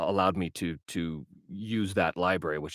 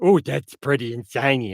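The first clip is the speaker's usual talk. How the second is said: higher, louder put-on cooing; mock covetous delight; 4 semitones higher, 6 dB louder than usual. Game collector confessing his impulse buying, hammed-up delight. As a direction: gush like a magpie spotting treasure. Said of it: "I see it, I go".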